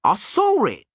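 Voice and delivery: high, indignant squeak; insist, offended; indignant gnome. A narrator's reading. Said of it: "the gnome said indignantly".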